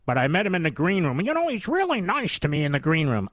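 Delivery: mocking imitation. Mimicking those charmed in the green room, mocking gush. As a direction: gush it mockingly, sing-song. Mimics people excusing the anchor.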